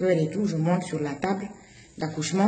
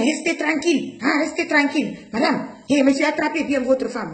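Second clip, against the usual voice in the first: retold doctor's yell; 7 semitones higher than usual. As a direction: shout at her to stay still. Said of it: "il crie, hein".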